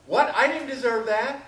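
high, loud hurt protest; indignant, caught off guard by the blow; shocked protest. Acted. Person hit by the bag of anger, shocked protest. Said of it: "that other person goes".

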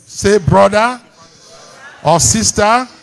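high preacher's voice building to a shout; rousing declaration. Real, not acted. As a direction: proclaim it for the crowd to repeat. Leads congregation in a declaration.

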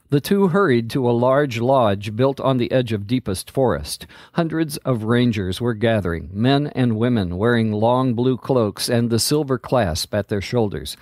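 ordinary delivery, nothing marked

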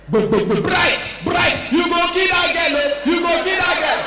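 high alarmed shout; panicked neighbors shouting through the door; neighbors yelling. Retold or imitated. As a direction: cry out to stop him.

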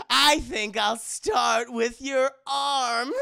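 slow, loud, gleeful threat; gloating villain. Retold or imitated. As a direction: relish the threat, breaking into a laugh. Villain threatens to break arm.

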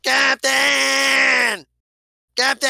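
high, drawn-out shout for the captain; yelling. Acted. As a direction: holler it into the comm, stretched out. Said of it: "he practically yells at it".

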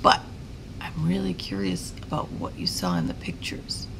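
ordinary delivery, nothing marked

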